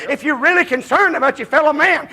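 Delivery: usual speaking voice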